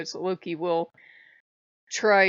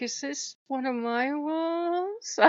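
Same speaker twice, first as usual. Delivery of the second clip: dazed question; 8 semitones higher, slower than usual. Retold or imitated. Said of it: "I was like".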